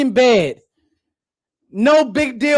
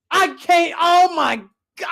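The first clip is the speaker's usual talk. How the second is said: ordinary talk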